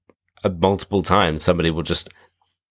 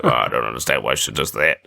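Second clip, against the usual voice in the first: mimicked male character; 7 semitones lower than usual. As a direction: lower mock man's voice.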